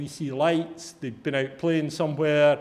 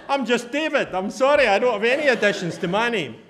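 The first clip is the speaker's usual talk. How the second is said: 1 imagined reply; 6 semitones higher, 4 dB louder than usual. Retold or imitated.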